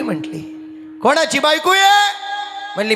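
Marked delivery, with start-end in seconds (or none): 1.0-2.1 high-pitched demanding question; indignant wife. Acted. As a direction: throw the question out high and sharp. जिजाबाईचा चढा सवाल. (चढ्या आवाजात जाब विचारत)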